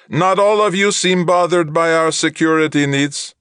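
high flat alien translator; evenly spaced machine words, no feeling; monotone translator voice. A narrator's reading. Troft translator voice.

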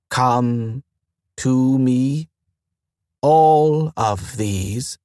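very slow, rising alarm; words stretched out in mild panic. Acted. Station AI hearing of visitors, slow mild panic. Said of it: "There was panic in the slow voice".

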